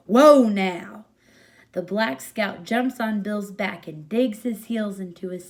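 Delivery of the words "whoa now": much louder call to a horse; shouting kid. Acted. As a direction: yell like spurring a horse. Kid spurs his horse.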